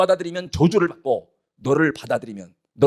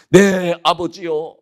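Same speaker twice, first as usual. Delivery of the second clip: acted plea; 4 dB louder, much slower than usual. Esau appeals to his father, slow acted plea. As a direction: stretch out the cry to a father. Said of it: "소리를 높여 막".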